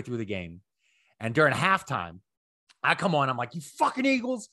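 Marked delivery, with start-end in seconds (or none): 3.5-4.5 high-pitched fed-up fan heckle; mock angry rant. Fan yelling at the Eagles during halftime, mock angry. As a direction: berate your losing team in a raised pitch.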